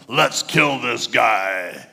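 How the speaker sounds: slow, dropped, mild menacing voice; mock mob menace. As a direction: turn it dark like a hostile mob. Crowd turning murderous.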